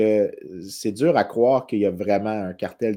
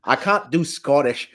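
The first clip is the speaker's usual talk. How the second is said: failed Scottish accent; 3 semitones higher, 4 dB louder, slower than usual. Shown as a demonstration. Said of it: "je l'aurais dit avec un accent écossais".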